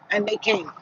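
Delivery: usual speaking voice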